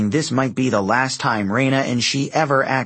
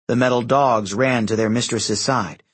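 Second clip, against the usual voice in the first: usual speaking voice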